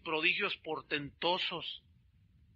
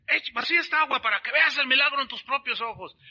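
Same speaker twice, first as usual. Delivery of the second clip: loud, higher stage command; showman preacher; 7 semitones higher, 7 dB louder than usual. Retold or imitated. The preacher orders the helper to pour, loud and high. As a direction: command it with showbiz flair. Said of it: "Entonces dice".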